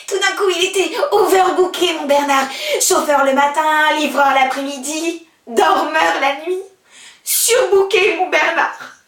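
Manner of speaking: loud, high, indignant recital; bitter outburst. Acted. Abandoned girl telling how he vanished overbooked, loud and bitter. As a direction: throw out each excuse loudly. Bitter account of his disappearance.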